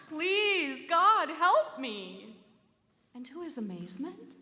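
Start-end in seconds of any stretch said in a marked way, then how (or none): 0.1-2.3 loud, slow, desperate wail; a loud pleading cry, drawn out slow; wailing louder. A narrator's reading. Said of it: "he wailed again, louder".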